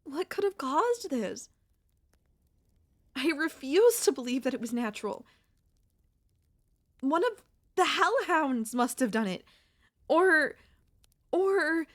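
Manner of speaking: rising upset outburst, then faltering; agitated insistence. Acted. Insisting the cave-in was an attack.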